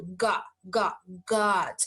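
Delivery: open, short o in "God"; open, quick vowel. Shown as a demonstration. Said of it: "é rápido".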